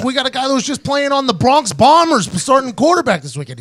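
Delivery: incredulous loud rant; mock exasperation. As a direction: argue it at the top of your range. Continues exasperated protest to coach.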